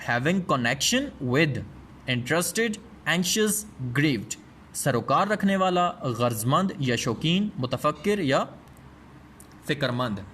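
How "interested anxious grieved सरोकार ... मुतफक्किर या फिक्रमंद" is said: usual speaking voice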